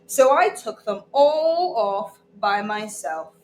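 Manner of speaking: ordinary delivery, nothing marked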